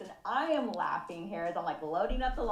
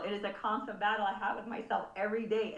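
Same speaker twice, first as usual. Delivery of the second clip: ordinary talk, nothing marked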